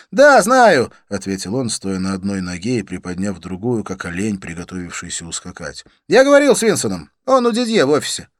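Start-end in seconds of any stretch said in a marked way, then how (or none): 0.1-0.9 impatient. A narrator's reading. Impatient friend cuts in while leaving. 6.1-8.3 hurried. A narrator's reading. Friend in a rush to leave.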